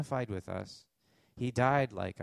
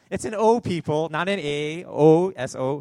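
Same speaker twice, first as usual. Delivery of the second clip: loud, higher mock-indignant correction; mock scolding; 4 semitones higher, 11 dB louder than usual. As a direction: playfully lecture the crowd at top volume. Mock-scolding listeners over his pronunciation.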